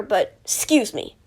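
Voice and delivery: burp while talking; words through a burp. Real, not acted. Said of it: "I don't know why I'm burping".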